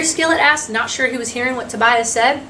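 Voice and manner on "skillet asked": ordinary delivery, nothing marked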